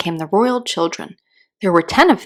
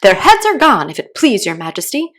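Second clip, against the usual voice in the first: shouted reply; 3 semitones higher, 7 dB louder than usual. A narrator's reading. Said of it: "the soldiers shouted in reply".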